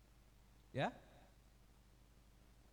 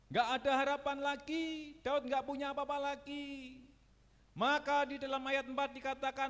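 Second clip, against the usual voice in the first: ordinary talk, nothing marked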